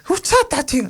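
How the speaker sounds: imitating a mom freaking out in a high squeal; mom yelling. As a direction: play the shocked mom shrieking at her kid. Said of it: "And she yells at me".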